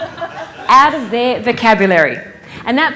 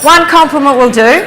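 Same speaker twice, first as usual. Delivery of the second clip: raised voice over the room's buzz; calling out; 4 semitones higher, 10 dB louder than usual. Real, not acted. Speaker calling over chattering audience, loud and raised. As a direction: call it out loud and higher to the crowd.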